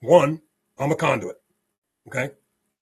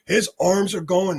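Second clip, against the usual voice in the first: usual speaking voice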